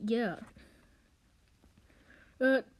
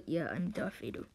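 ordinary talk

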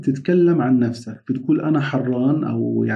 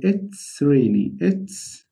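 it's rainy said very slowly; slow teacher demo; slow model phrase; much slower than usual. Shown as a demonstration. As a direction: stretch out it's rainy for learners. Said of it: "فبقول".

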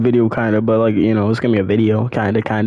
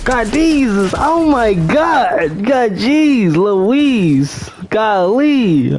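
excited; 7 semitones higher, much slower than usual. Real, not acted. Stunned by game event.

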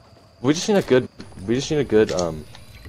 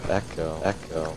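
toy echo microphone; 4 semitones lower, 8 dB quieter, slower than usual. Pretend. Mimicking an echo effect.

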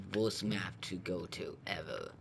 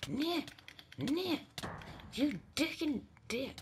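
squeaky taunt; slower than usual. Pretend. Squeaky high voice taunting enemy.

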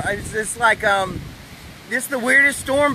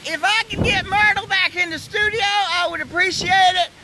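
mock news anchor; 6 semitones higher than usual. Mock news anchor catastrophe report.

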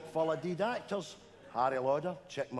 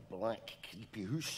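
1 slow spooky hush; 3 semitones lower, 7 dB quieter, slower than usual. Pretend. Spooky buildup in story.

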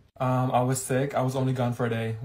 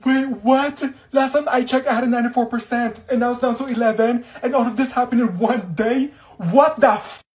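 loud, high disbelief; shocked outburst; 9 semitones higher, 9 dB louder than usual. Acted. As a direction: yell it out in shock. Shocked at the eleven percent grade.

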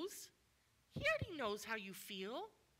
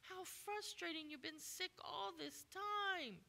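higher, pitying coo; mock sympathy; 4 semitones higher, slower than usual. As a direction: gush over him with fake pity. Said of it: "Jesus didn't say".

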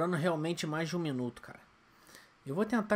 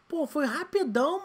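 high, slow exasperated protest; 9 semitones higher, 5 dB louder than usual. Real, not acted. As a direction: whine it in disbelief. Exasperated at failure.

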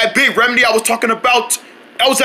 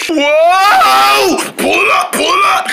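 panicked shout; 8 semitones higher, 3 dB louder, much slower than usual. Acted. Flying out of control, panicking.

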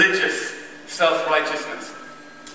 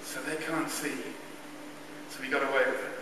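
much softer relieved murmur; relieved inner thought; 12 dB quieter than usual. Retold or imitated. Choirboy realising nobody noticed, hushed relief. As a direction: think it to yourself with relief. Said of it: "I remember thinking".

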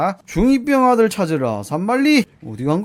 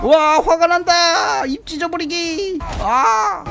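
high, loud roaring shout as the furious bear; angry bear roar; 11 semitones higher, 3 dB louder, much slower than usual. Pretend. Streamer voicing the bear as it turns angry, roaring shout. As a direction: bellow it like a bear losing its temper.

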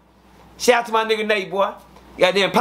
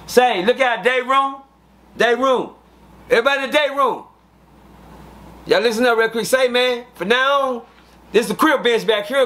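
raised, higher voice announcing to everyone; calling out to the room; 4 semitones higher than usual. Retold or imitated. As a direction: address the whole day room, projecting. Calling out to whole day room.

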